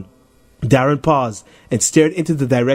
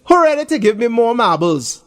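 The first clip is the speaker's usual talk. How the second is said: cocky challenger; 9 semitones higher than usual. Acted. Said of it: "Tony says as he scans the crowd".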